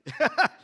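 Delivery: short high burst of laughter; breathless laugh. Real, not acted. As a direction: laugh it off, winded.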